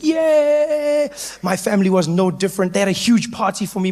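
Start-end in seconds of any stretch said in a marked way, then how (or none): 0.0-1.1 high little cheer; a quick celebrating yay; mock cheer. Crowd cheering the end of apartheid, a high yay. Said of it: "People were cheering".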